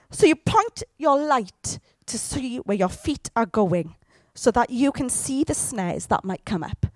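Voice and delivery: normal talking voice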